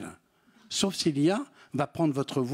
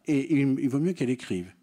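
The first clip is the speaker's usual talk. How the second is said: normal talking voice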